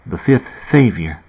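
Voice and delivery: a hint of timidity, unsure to speak. A narrator's reading. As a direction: Fat timidly raising the subject, quiet. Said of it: "Fat began timidly".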